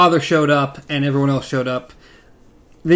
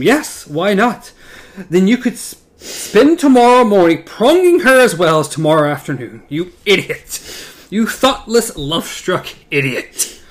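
raised, higher, louder outburst; half-scream the insult at him; furious friend losing patience, raised voice; held-back scream; 5 semitones higher, 5 dB louder than usual. Acted. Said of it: "wanting to scream".

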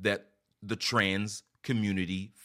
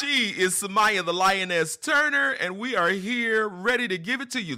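high, loud hype introduction; announcer; 9 semitones higher, 5 dB louder than usual. Acted. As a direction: introduce her like a ring announcer.

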